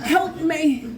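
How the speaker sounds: high, comic desperate begging; hammed-up pleading. Addict begging the helpline man, hammed-up pleading. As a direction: beg over and over, half laughing at yourself.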